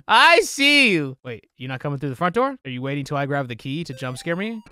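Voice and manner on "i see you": taunting. Real, not acted. Taunting hidden monster in game.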